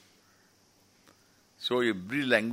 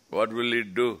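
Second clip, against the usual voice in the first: ordinary delivery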